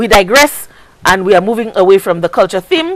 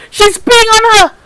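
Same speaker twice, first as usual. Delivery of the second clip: "peeing" pushed up high in shock; outraged disbelief; 10 semitones higher than usual. Real, not acted. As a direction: cry it out, appalled. Outraged retelling of child's fear.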